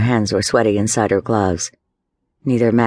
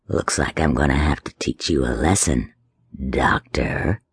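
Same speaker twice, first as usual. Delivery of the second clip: put-on low, quieter menacing growl of a drunk; 8 semitones lower, 4 dB quieter than usual. A narrator's reading. Drunk leaning close to threaten Johanna, low quiet menace. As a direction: loom over her and threaten.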